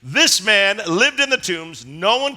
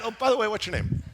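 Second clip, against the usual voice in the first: soft, quick casual afterthought; breezy, quick, much softer question; offhand question; 7 dB quieter, faster than usual. Acted. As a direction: drop to a chatty aside. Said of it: "what an odd thing to say".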